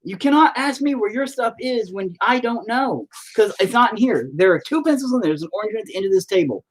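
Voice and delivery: high-pitched, insistent parental lecture; exasperated scolding. Real, not acted. Parent scolding child over pencil.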